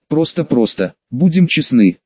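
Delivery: usual speaking voice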